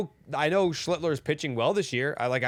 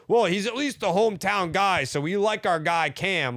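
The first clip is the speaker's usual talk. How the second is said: mock fan voice; 6 semitones higher, 3 dB louder than usual. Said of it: "Did you think they'd be like".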